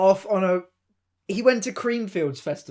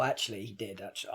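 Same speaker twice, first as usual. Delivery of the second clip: ordinary delivery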